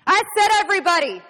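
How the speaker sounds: very loud, high shout; furious command. Acted. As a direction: explode at the timid objection. Inspector shouts down the timid objection.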